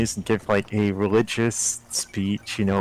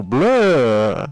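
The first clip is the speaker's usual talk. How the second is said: louder drawn-out vampire "blah"; 2 semitones higher, 7 dB louder than usual. Shown as a demonstration. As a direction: show the classic vampire blah. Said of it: "that's not a vampire voice".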